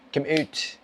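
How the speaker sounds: "out" said as "oot" in a mock Scottish accent; rough attempt at an Irish-Scottish accent. Shown as a demonstration. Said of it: "Irish, Scottish, whatever you want to call".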